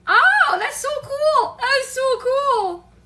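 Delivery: high, loud gushing, last words stretched; thrilled. Acted. Picturing herself in Los Angeles, thrilled. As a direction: exclaim in sheer delight.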